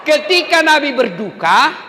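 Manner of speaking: high, loud, impassioned preaching; preaching at full force. Acted. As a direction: cry it out to the crowd. (khotbah berapi-api dengan suara tinggi)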